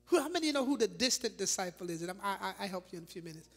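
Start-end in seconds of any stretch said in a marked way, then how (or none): none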